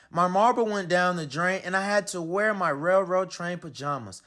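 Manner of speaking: ordinary delivery, nothing marked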